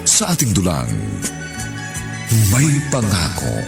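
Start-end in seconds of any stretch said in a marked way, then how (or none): none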